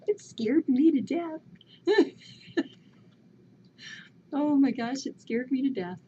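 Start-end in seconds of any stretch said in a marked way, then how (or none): 0.0-2.1 laughing, slow and high; real laughter through the words. Real, not acted. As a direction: laugh it out in disbelief.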